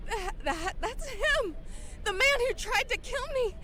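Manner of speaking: high, stammering cry of panic; frightened sob pointing him out; panicked recognition. Acted. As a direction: stutter out the accusation in terror. Victim recognizes her attacker.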